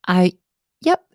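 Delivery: ordinary talk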